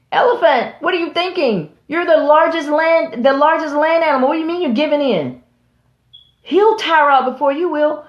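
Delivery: rally him before he gives in; impassioned high call; urgent plea. Real, not acted. Preacher pleads with the elephant.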